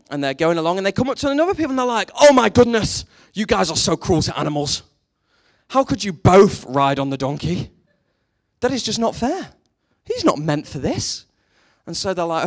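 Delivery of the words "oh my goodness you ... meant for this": hammed-up indignation, loud burst at the start; mock outrage. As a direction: play an appalled animal lover. Outraged animal lovers in fable.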